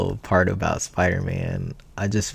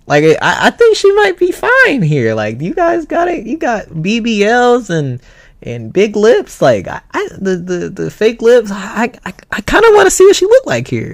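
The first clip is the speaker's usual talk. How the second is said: high giddy voice; 11 semitones higher, 3 dB louder than usual. Retold or imitated. Friend voiced in retold story.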